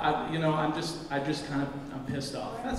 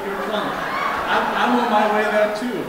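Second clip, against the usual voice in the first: laughing; 4 semitones higher, 7 dB louder than usual. Real, not acted. Joking with drunk audience, laughs.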